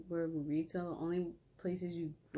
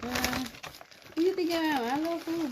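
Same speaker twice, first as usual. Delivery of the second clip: high loud sassy voice; mock sassy viewer; 10 semitones higher, 4 dB louder than usual.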